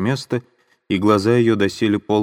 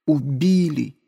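horrified cry of realization; sudden outcry; 8 semitones higher, slower than usual. Acted. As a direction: burst out with it. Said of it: "И убили".

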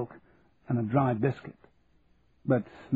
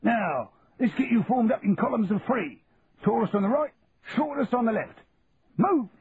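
drill-square orders building to a yell; sergeant major barking orders; 10 semitones higher than usual. Acted.

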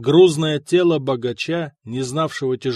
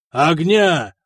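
loud, higher shouted order; yelling; 3 semitones higher, 6 dB louder than usual. A narrator's reading. Prefect orders fire for the prisoner, yelling loudly. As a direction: bark the order at the guards. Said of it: "заорал префект".

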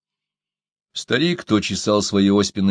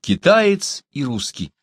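ordinary delivery

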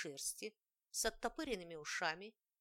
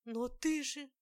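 startled; 6 semitones higher, 6 dB louder, slower than usual. A narrator's reading. Heroine stammers at rat.

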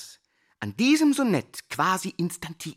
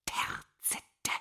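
breathy whisper of the word Terzette; 9 dB quieter than usual. Acted. Teacher whispering a word to the class, hushed whisper. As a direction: let the word dissolve into a whisper.

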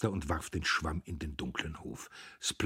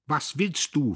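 higher, louder angry question; angry sister at the door; 9 semitones higher, 6 dB louder than usual. A narrator's reading. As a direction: snap it at him. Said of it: "fragte sie zornig".

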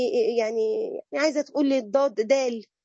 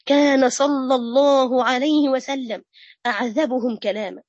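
slow, drawn-out reverent praise, louder; solemn preaching; 4 dB louder, slower than usual. Acted. Preacher extolling the Prophet to her students, slow and solemn. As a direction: stretch each word like a sermon.

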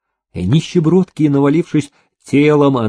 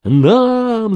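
high, drawn-out clamour; crowd's cry; 7 semitones higher than usual. A narrator's reading. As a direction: call out greedily, second cry higher. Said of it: "закричали все разом".

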